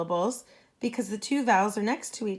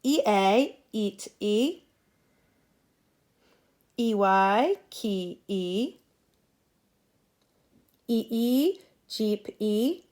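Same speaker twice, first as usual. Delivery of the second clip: call and repeat drill; slower than usual. Shown as a demonstration. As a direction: spell out each vowel team, then the word, then its sound. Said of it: "you're gonna repeat after me".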